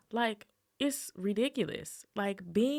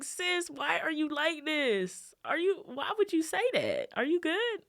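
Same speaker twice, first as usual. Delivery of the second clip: much higher, incredulous sis voice; mock disbelieving friend; 10 semitones higher than usual. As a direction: act baffled at your sister. Voicing friend reacting to rival.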